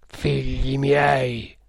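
slow, solemn, forceful address; chilling cult leader. A narrator's reading. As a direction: chill them with every drawn-out syllable. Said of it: "con un accento da far fremere".

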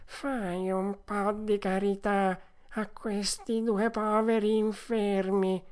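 slow, high begging whine; whining fox. A narrator's reading. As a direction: beg for alms in a drawn-out wail. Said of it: "gridò la volpe con voce di piagnisteo". (piagnisteo lento di volpe mendicante)

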